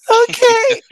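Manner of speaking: very high, laughing through it; laughing okay. Retold or imitated. Answering her husband, a high laughing okay. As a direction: squeak it out with a laugh. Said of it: "I was like".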